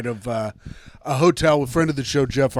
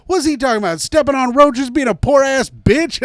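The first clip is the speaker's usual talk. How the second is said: loud, higher mocking yell; mocking shout; 9 semitones higher, 5 dB louder than usual. Miss Pat mocking another comic's act, loud scoff. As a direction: scoff loudly at the other comic. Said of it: "She goes".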